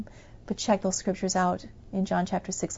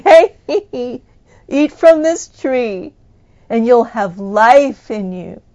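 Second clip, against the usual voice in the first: tree of life calling; 2 semitones higher, 12 dB louder, slower than usual. Acted. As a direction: call them in, slow and big. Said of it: "he's standing up and saying".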